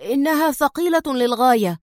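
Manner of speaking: a guard straining at a heavy sofa, sharp and strained; sharp complaint. A narrator's reading. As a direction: snap it out as you heave. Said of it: "والمساعد يقول في حدة".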